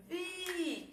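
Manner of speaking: Vi held long and high; stressed syllable drawn out. Shown as a demonstration. Teacher finding the tonic syllable, Vi drawn out. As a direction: call out a high, stretched Vi. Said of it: "acabei de encontrar minha sílaba tônica".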